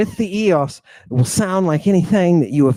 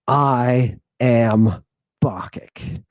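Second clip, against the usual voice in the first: normal talking voice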